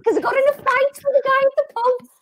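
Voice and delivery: high-pitched put-on Scouse; Scouse accent. Shown as a demonstration. Host performing a Scouse line on request, high Scouse accent. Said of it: "Now you gotta say chicken and chips".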